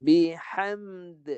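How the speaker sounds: Bihamd with the final d bounced; a teacher's model reading of Bihamd; model recitation. Shown as a demonstration. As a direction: read Bihamd for the pupils to copy, ending d rebounding. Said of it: "Cuba baca".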